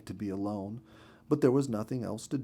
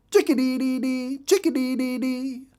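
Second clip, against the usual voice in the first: very high, loud, sing-song bird call; saucy little bird chirping its name; saucy chickadee call; 13 semitones higher, 5 dB louder than usual. A narrator's reading. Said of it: "shouting his name after her".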